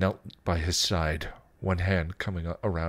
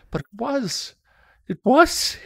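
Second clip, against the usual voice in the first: high, strained-up pitch, growing louder; desperate insistence; 7 dB louder than usual. Acted. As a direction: insist on it, pleading to be understood. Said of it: "he insisted".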